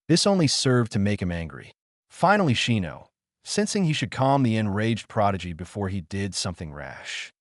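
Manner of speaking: ordinary delivery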